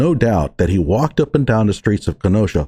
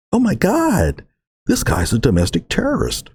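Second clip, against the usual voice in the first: mock alarm; 5 semitones higher than usual.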